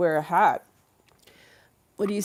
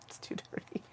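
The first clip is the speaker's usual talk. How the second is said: hushed whisper of the same words; whispering it to the audience; 17 dB quieter than usual. Shown as a demonstration. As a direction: breathe it out as a secret.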